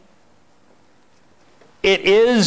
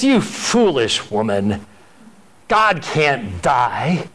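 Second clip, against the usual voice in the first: gruff scolding husband; much slower than usual. Retold or imitated. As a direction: drag out a mild rebuke to his wife. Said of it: "He says".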